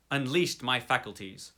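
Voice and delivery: usual speaking voice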